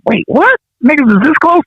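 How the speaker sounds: very high, incredulous reaction; retold astonishment. Group arriving two blocks from home, retold astonished disbelief. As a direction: squeak it out in mock disbelief. Astonished at how close it was.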